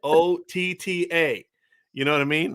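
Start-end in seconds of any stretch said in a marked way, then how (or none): 0.0-1.4 slow, high letter-by-letter spelling; each letter set apart, exasperated; emphatic spelling out. Retold or imitated. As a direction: spell it out to prove a point. Spells word to win argument.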